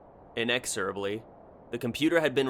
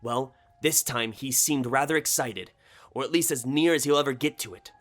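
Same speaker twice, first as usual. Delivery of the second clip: higher, animated character voice; eager colleague; 4 semitones higher than usual. Acted. Chuck tells George what Sam confessed, higher and eager. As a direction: relay the news in a lively, raised pitch.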